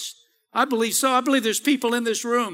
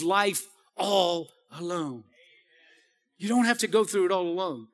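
tender reassurance; 6 semitones lower, 7 dB quieter than usual. Real, not acted.

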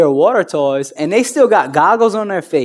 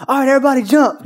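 high, louder call to jump; mock worship leader shouting to the crowd; worship leader hype; 10 semitones higher, 3 dB louder, faster than usual. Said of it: "they like".

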